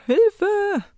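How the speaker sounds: high, playful plea for rescue; mock cry for help. Teacher jokes about craving chocolate, mock-desperate cry. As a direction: cry out in pretend panic.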